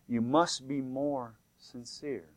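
slow, softened solemn instruction; voice from heaven. Retold or imitated. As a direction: let each word land slowly and quietly. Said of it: "He said".

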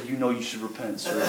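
a soft private prompting; gentle nudge. Retold or imitated. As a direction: lay it on them gently as God would. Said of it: "and say".

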